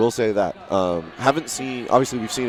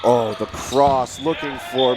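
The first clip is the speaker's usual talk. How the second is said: normal talking voice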